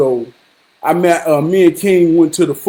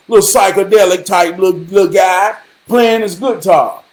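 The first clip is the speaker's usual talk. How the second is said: ordinary delivery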